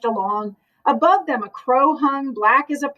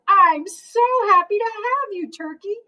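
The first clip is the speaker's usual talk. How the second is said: delighted; 8 semitones higher than usual. Acted. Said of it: "clapped her hooves".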